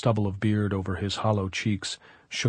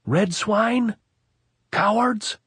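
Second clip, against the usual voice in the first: high, loud jeering yell; taunting shout; 13 semitones higher, 4 dB louder, much slower than usual. Acted. Officer jeering at the hilltop, loud angry yell. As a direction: hurl the insults up the hill.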